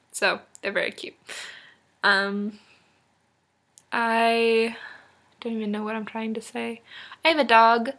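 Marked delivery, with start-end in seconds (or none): none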